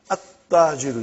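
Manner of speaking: "At-Tajiru" with a held double t and no l; lam skipped. Shown as a demonstration. Teacher showing where the tongue touches, "At-Tajiru" with lam skipped. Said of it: "your tongue has to touch".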